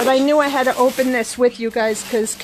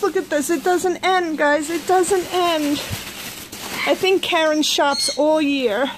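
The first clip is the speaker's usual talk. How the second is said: normal talking voice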